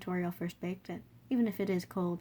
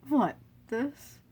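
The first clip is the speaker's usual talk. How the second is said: laughing, louder and higher; hearty laugh; 5 semitones higher, 9 dB louder than usual. Acted. King laughing off the wound, hearty. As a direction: shrug it off with a laugh. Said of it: "Asgore laughs".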